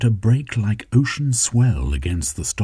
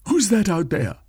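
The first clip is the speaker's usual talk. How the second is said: much higher, far-off servant voice; Gentleman Smythe, alarmed; 13 semitones higher than usual. Acted. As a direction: call out warily into the dark.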